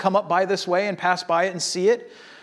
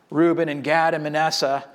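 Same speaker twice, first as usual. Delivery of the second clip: ordinary delivery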